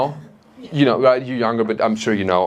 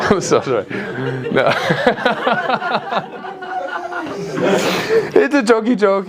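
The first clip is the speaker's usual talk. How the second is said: laughing; 8 semitones higher than usual. Real, not acted. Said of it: "I'm kidding".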